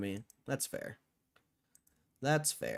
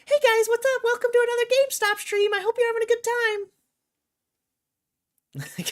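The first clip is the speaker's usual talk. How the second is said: much higher, louder cheery streamer greeting; 10 dB louder, much faster than usual. Shown as a demonstration. Mocking a peppy streamer greeting chat, falsetto. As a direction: chirp it like an overly peppy streamer. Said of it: "I couldn't do that for seven hours".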